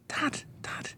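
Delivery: put-on excited kid's whisper. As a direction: lean in and whisper to dad. Kid whispering to dad.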